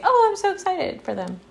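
high gushing happy voice; mock excited gush. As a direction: gush like a thrilled friend. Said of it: "Or do you say".

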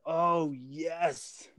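high-pitched mock celebration; retold private little cheer of triumph; elated. Young soldier celebrating his new job, elated.

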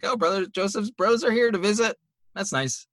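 high, breezy pleased voice; lightly put-on merry Pharaoh; mock Pharaoh. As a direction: play Pharaoh cheerfully unaware. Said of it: "it's just like".

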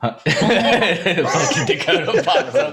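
laughing through the words, high and slow. Real, not acted. Laughing as a friend pops onto the stream. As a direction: crack up as you say it.

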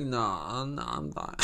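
put-on low, soft drunken refusal; mumbly imitation of a drunk friend; wasted friend. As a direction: imitate a friend who is out of it, sinking low and quiet. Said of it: "that boy was gone already".

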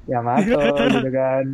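ordinary talk

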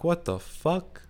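slow, high-pitched retold disbelief; incredulous. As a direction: draw it out in baffled protest. Retells his shocked reaction to accusation.

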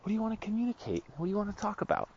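high, soft and eager; chipper delight. Retold or imitated. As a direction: gush like it's the best idea ever. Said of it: "here's what I said".

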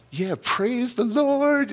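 high, slow, ringing praise; joyful praise. Retold or imitated. Pushed up the hill, he praises God aloud, joyful and high. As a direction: sing out thanks to the Lord. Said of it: "I'm hallelujahing all the way".

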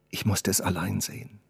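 barely audible. Acted. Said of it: "Und kaum hörbar".

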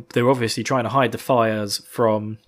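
ordinary talk

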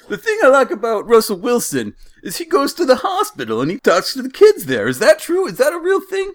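very high cartoon Jersey accent. Driver asks about the hospital visits, hammed-up Jersey accent. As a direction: quiz them as an exaggerated Jersey driver. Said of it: "The voice you're doing".

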